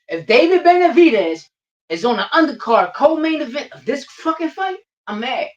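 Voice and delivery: high and loud, fired up; heated rant. Real, not acted. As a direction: push it up, worked up over the card. Heated rant about fight card.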